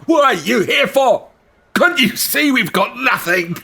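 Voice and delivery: put-on high old commoner, bitter call; old man calling out. Weathered old man to the newcomers, high bitter call. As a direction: demand answers. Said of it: "He calls out".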